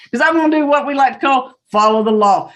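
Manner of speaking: normal talking voice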